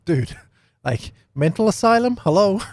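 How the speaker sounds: high-pitched, hammed-up incredulity; mock disbelief. Preacher acts out our reaction to crazy talk, mock incredulous. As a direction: play at calling out someone crazy. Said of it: "we'd be like".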